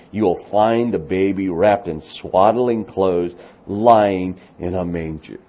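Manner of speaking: proclaiming the angel's words, loud and measured; scripture reading. Acted. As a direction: read it out like a herald's announcement. Reads angel's words aloud.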